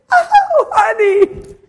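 loud, very high mock squeal; imitated gushing wife. Husband imitating his wife's hard hug, squealed gushing. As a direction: squeal it like an overjoyed wife, exaggerated.

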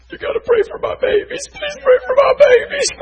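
very high, squeaky frantic plea; mock a fretful mother begging; hammed-up pleading mother. Pleading mother voiced in story.

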